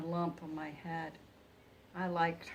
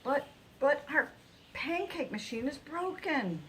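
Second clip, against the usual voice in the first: higher, loud worried moan; mild moan; 7 semitones higher, 4 dB louder than usual. A narrator's reading. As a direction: moan it, fretting. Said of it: "moaned Carson".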